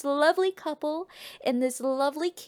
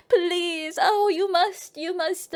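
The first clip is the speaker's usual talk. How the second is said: higher-pitched theatrical begging; pleading queen; 6 semitones higher, slower than usual. Retold or imitated. Widowed queen begging the new king, theatrical pleading. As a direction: implore as a hammed-up damsel.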